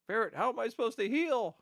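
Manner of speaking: high, loud mock plea; mock exasperated wail. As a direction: wail it at a teammate in comic despair. Acts out plea to teammate Ferret.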